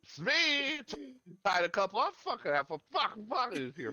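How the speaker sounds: put-on high, slurred drunk guy leaving a message; drunk dad voicemail. Drunk dad leaving a late-night voicemail, slurring high.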